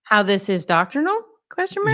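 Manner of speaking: "doctrinal" lifted into a question, "Question mark" pitched very high; exaggerated upward question tune, topping out on "Question mark"; questioning inflection. Shown as a demonstration. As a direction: turn "How this is doctrinal" into a question with a rise on "doctrinal". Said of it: "You gotta do the voice inflection".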